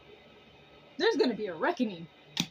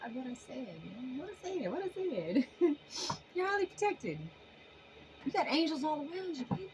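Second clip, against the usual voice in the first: soft, tearful, voice catching; getting emotional for the viewers; choked up; 4 semitones higher, 7 dB quieter than usual. Real, not acted. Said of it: "I'm so emotionally".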